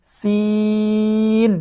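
সিন with a long held ee; three-alif stretch. Shown as a demonstration. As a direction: draw out the vowel of সিন evenly for three alifs. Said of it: "তিন আলিফ টান". (সিন তিন আলিফ টেনে)

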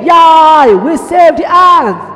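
loud, high victory cheer. Acted. Diego cheering that the ants are saved, loud drawn-out cheer. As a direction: stretch it out in celebration.

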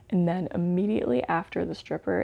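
normal talking voice

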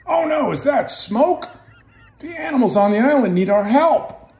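urgent high boat voice; alarmed Tugboat. Acted. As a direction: call for help about smoke. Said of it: "it was Tugboat".

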